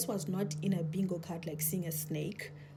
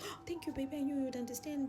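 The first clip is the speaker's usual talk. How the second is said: sweet relief; 7 semitones higher than usual. Retold or imitated.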